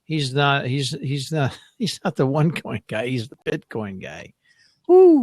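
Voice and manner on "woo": a big, loud, high cheer; excited whoop. Real, not acted. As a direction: let out a sudden joyful whoop. Host cheers Bitcoin guy mention.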